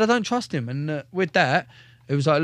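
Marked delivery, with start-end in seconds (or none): 0.0-0.7 angry outburst. Retold or imitated. Telling the padre he refuses his sergeant, high outraged protest. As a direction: shoot the voice right up in outrage.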